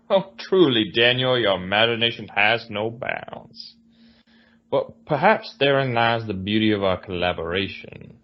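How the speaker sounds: put-on amused Southern gentleman, Southern accent; mock-lofty Southern genteel accent. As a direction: marvel at him in a grand Southern accent. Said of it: "The Southern genteel".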